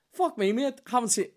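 high, delighted retold greeting; excited reunion. Greeting a stranger he mistook for a bandmate, high and delighted. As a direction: burst out happy to see an old bandmate.

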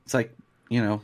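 ordinary talk

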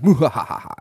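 loud, high mock villain cackle; exaggerated movie-villain "mwa ha ha"; Bond villain laugh. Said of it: "We always sound like Bond villains".

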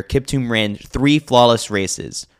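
ordinary talk, nothing marked

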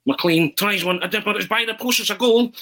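excited commentator impression, high and building; Arthur Montford. Schoolboy entertaining teammates after the match, excited commentary impression. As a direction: mimic a football commentator rising to the goal. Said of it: "that's where the kind of impersonation came from".

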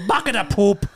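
loud, high leprechaun parody; hammed-up Irish mascot voice; mock Irish accent. Parodies leprechaun cereal list.

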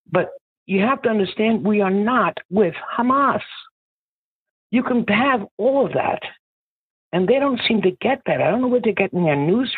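pushing the point hard, voice climbing; heated rant. Real, not acted. Caller ranting to the host about the right. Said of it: "Sorry, that was a rant".

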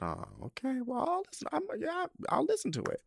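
high, sing-song put-on compliance; mock reluctant agreement. To friend pushing the [name] album, high mock reluctance. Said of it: "I said".

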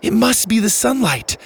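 put-on high-pitched, urgent girl's voice; worried girl. Girl calls out over friends' pain.

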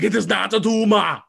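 hammed-up Arnold Schwarzenegger accent, "It is not a tumor". Arnold answering the kid, in a loud Austrian accent. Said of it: "having way too much fun with this accent".

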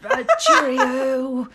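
drawn-out, hammed-up British accent; British old man. Guest tests an accent for the host, hammed-up British old man. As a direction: try a slow, laughing British old man. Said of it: "channel your most British, most inner old man".

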